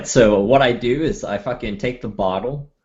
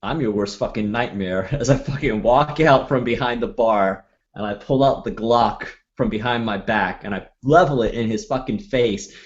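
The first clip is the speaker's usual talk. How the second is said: ordinary delivery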